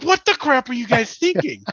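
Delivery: high, half-shouted angry dad; mock angry dad. Dad scolding sons tossing shoes, mock angry yell. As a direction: scold the boys in a mock fit. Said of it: "I'm like".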